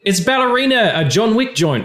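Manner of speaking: high, punchy title reveal; hyped announcement. Acted. Hyped trailer-style show intro.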